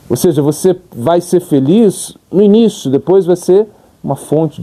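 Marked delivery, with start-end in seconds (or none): none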